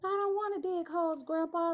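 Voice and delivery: high whiny little voice; whiny cat voice. Pretend. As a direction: whine it like a sulky kitten. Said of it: "that's what I see".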